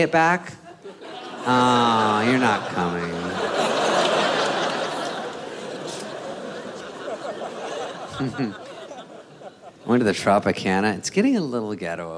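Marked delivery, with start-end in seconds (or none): 3.2-9.0 silent wheezing laugh. Real, not acted. Laughing at own bit.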